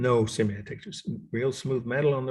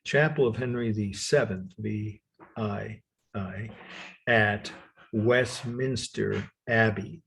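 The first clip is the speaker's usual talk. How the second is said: slowly, in separated chunks with pauses; slow dictation; slower than usual. Shown as a demonstration. Lecturer repeating a long title for note-takers, slowly. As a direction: spell out each letter unhurriedly. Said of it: "so I'll say it slowly".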